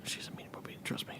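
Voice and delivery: conspiratorial whisper. Real, not acted. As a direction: hush it like a secret. Secret aside about the teacher.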